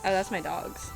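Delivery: ordinary delivery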